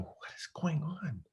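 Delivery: tense whisper to himself; whispered self-talk in panic. Retold or imitated. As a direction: hiss it under your breath, rattled. Panicking alone in showroom.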